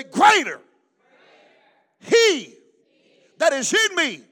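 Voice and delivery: loud scripture in short phrases; punchy, shouted pulpit lead; call-and-repeat preaching. Shown as a demonstration. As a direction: call it out piece by piece for the crowd to echo. Leads congregation in call and repeat.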